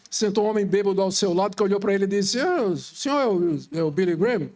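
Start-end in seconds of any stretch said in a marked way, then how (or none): 2.4-4.5 lightly imitating a drunk stranger. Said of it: "Eu não sei imitar bêbado".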